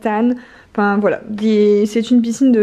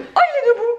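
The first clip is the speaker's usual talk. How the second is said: much higher, laughing with amazed delight; delighted surprise; 12 semitones higher than usual. Real, not acted. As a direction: squeal it out in disbelief. Child's new ball pit surprise.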